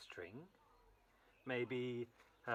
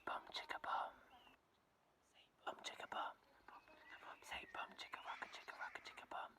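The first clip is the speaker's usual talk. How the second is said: whispered chant; 9 dB quieter than usual. Shown as a demonstration. Storyteller leads children in a whispered chant. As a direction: lean in and whisper the rhyme. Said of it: "Really quietly".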